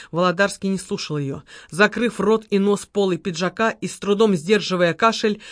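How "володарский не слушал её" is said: ordinary delivery, nothing marked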